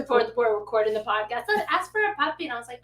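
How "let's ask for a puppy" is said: put-on pleading child. Imitates child asking for puppy.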